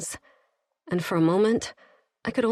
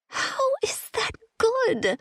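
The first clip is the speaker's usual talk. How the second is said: hiss; slower than usual. A narrator's reading. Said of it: "I hissed at him".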